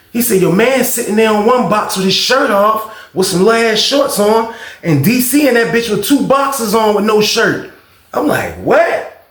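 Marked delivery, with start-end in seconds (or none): 0.4-7.7 high-pitched gossiping roommate; scandalized report. Retold or imitated. Roommate describes the shirtless men in the cell, imitated scandalized report. Roommate recounts shocking sight. 8.6-9.3 high rising "what"; shocked disbelief. Retold or imitated. Storyteller reacts to roommate's news, re-enacted shocked question.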